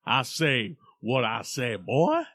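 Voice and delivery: put-on loud southern rooster drawl, booming on boy; slow, far-off cartoon southern drawl; Foghorn Leghorn. Host mimics a cartoon rooster's southern drawl. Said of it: "I think of Foghorn Leghorn".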